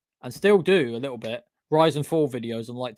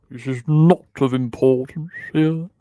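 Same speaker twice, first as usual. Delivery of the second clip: louder, theatrical mock character voice; silly put-on voice; 5 dB louder than usual. Dismissing James as not on the list, silly voice. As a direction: repeat it as a hammed-up catchphrase. Repeats own phrase in silly voice.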